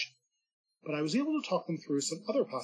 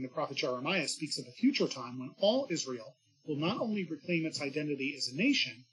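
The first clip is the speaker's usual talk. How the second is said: ordinary delivery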